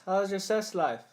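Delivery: put-on odd accented actor, high and stilted; oddly cheerful stilted actor; Tommy Wiseau. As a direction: deliver it as awkward bad-movie small talk. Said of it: "ready to do my impression".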